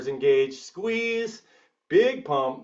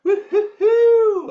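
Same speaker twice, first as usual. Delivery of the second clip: high, loud laughing woo-hoo, drawn out slow; laughing whoop; 10 dB louder, slower than usual. Real, not acted. Coach whooping to hype the class before curls, laughing.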